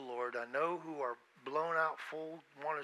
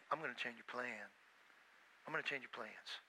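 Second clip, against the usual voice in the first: lower, much softer, calm divine voice; voice of God; 7 semitones lower, 14 dB quieter than usual. Retold or imitated. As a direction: quietly overrule their plans. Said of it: "God can say".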